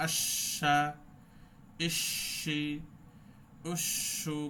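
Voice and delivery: আশা with a long hissing শ; slow shin practice; held shin drill. Shown as a demonstration. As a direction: hold the air on শ. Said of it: "একটু ধরে তারপরে পড়বো".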